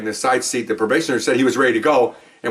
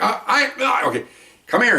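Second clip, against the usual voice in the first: higher, halting stuttered words; deaf speech imitation; 9 semitones higher than usual. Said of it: "almost its own language kind of thing".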